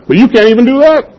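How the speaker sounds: high, quick, incredulous paraphrase; Jesus, mock incredulous. Preacher voicing Jesus chiding the anxious listeners, high mock disbelief. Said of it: "he's saying".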